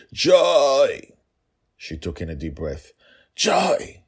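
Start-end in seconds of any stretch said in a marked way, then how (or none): 0.1-1.1 calling out sharply, high and loud; loud stage whisper. A narrator's reading. Father calling his daughter from a distance, loud stage whisper. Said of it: "he whispered as loudly as he could". 3.3-4.0 high, urgent stage whisper call; loud whisper. A narrator's reading. Father trying to warn daughter, urgent loud whisper. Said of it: "he whispered loudly".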